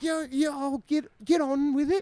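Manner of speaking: high mum voice shooing; mom's voice. Retold or imitated. Mum shooing the couple to bed, high and brisk. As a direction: wave them off like a mum. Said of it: "she's like".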